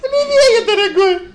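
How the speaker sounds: high sweet wifely falsetto; man doing a woman's voice. Retold or imitated. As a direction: chirp it like a cooing wife. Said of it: "добрым женским голосом говорит".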